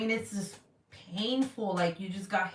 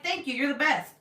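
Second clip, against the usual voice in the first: high, grateful gush; acted-out delighted gratitude; gushing thanks; 6 semitones higher than usual. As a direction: thank them effusively, pitched up. Acting out thanks to the driver.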